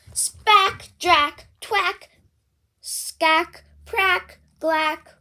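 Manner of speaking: high, loud brassy blurt into the trumpet. Acted. Piggy blows her trumpet for Gerald, a loud honk. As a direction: blast a bad trumpet note.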